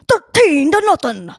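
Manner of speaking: big put-on play-by-play voice; funny announcer voice. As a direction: ham up the score call like a TV announcer. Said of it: "I'm just doing funny voices".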